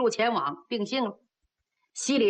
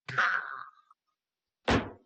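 whispered clack; 11 semitones lower, 3 dB quieter than usual. A narrator's reading. 兵器相撞.

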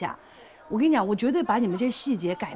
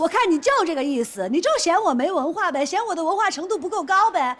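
loud, raised-pitch protest; indignant shouting; 5 semitones higher, 4 dB louder than usual. Acted. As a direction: argue your case at full volume, affronted. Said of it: "好，好，好，好，别喊，". (激动地高声争辩)

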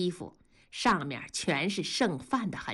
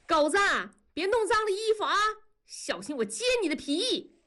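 high, fast call across the yard; mother calling her son across to the court, high and fast; calling out far; 13 semitones higher than usual. A narrator's reading. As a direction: sing it out to a kid far off. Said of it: "悠扬的声音就飘了过去".

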